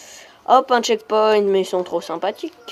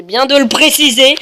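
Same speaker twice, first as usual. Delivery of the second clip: loud, higher declaration; playful, raised-voice announcement; mock-emphatic shout; 5 semitones higher, 10 dB louder than usual. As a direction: blurt it out with force.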